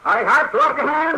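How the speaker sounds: high, loud command to surrender; commanding shout. Acted. Doctor springing the ambush on the gunman, loud commanding shout. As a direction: bark the order to freeze him.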